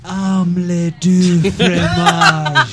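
sultry narrator. Acted. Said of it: "I'm whispering all of them".

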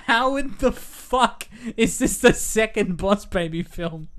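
high, slow disbelief; can't believe it exists; drawn-out baffled outrage; incredulous. Real, not acted. Incredulous rant about sequel.

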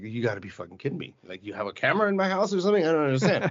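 high, fast, amazed disbelief; can't believe it, voice shooting up; incredulous disbelief. Retold or imitated. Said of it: "I'm like".

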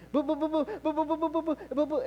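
high-pitched stammered objections; sputtering protest. Acted. Believer interrupting God with objections, mock-frantic sputtering. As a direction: stutter it in mock panic.

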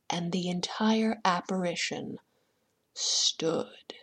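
soft, half-whispered reveal; slow it down and let it sink; spooky, barely voiced narration; eerie hush. A narrator's reading.

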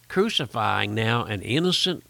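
ordinary delivery

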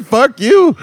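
loud, high defiant fuck-off; defiant yell. Retold or imitated. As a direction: fire it back at them, laughing. Retold defiant retort.

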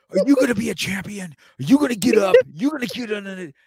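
high, loud pumped-up chant; hyped-up hype man. Retold or imitated. Dad imitating his workout music, pumped-up shouting. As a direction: fire yourself up like a workout track. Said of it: "I'm listening to music that's just like".

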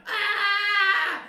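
loud, very high mock scream; long wail, hammed up; imitated agonised scream. Imitating brother hurt on the rocks below, agonised scream.

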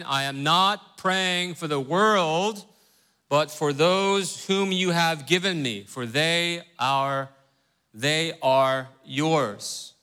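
scripture reading. Acted. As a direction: lift the Bible text out of the teaching talk. Reads scripture aloud.

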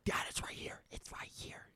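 urgent whisper. Retold or imitated. Son whispers to dad where the hornet landed. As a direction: hiss it so the hornet won't hear. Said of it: "and I was like".

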